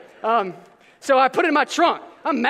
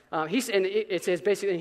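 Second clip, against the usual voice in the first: usual speaking voice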